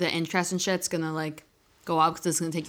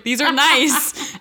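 slow, loud delighted quote; 6 semitones higher, 5 dB louder, much slower than usual. Retold or imitated. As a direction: savor it like a treasure. Retold find in trash.

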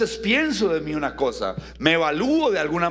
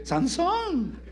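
a high, falsetto cry of Sansón, laughing; hammed-up woman calling Sansón; mock woman's cry. Shown as a demonstration. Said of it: "que grite".

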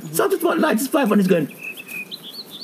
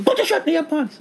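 high-pitched court official telling him off; much higher mimicked telling-off; mock scolding; 12 semitones higher than usual. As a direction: scold in a high, squeaky imitation. Repeats the woman's scolding.

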